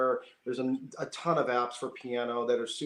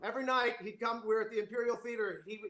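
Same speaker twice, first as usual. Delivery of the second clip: ordinary talk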